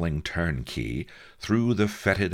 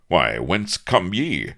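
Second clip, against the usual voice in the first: louder, higher, slow archaic greeting; stately old-time hero calling out in surprise; astonished heroic captain; 4 semitones higher, 7 dB louder, slower than usual. Acted. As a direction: ask it grandly and slowly. Avery greets visitor.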